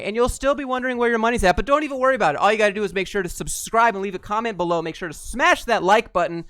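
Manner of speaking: mocking a get-rich course seller to viewers, loud and high; hyped YouTuber voice. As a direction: sell the fake course like a screaming influencer. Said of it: "this is how you have to be when you open up every single video".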